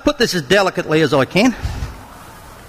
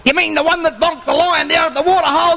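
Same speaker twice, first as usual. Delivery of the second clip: cheeky monkey voice; 8 semitones higher than usual. Acted. Monkey answers the lion.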